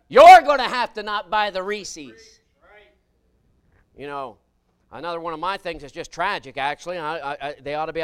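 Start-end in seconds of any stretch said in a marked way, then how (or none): 0.1-2.4 loud, high and drawn out, word by word; slow preaching punch. Acted. Preacher pressing the point on the pews, slow and loud. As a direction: lean on every word like a verdict.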